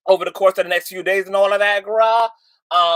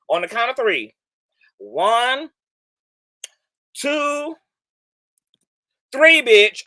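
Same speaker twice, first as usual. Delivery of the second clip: dramatic countdown; 4 semitones higher, slower than usual. Real, not acted. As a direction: build suspense then hit three. Counting down to drop.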